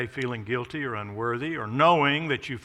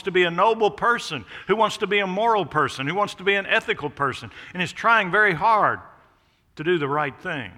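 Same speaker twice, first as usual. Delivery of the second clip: quickening preaching; 5 semitones higher, 5 dB louder than usual. Acted. Listing the strivers, faster.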